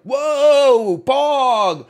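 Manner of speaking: put-on dad cheering on a stranger's babbling child; over-the-top excitement. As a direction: hype the kid up like it's the best thing ever. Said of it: "Now I'm like".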